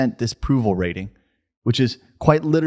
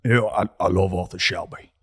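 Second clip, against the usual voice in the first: put-on Brummy accent; 2 semitones lower, 4 dB quieter than usual. Co-host showing his favourite accent, Brummy accent. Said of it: "love doing the Cockney accent".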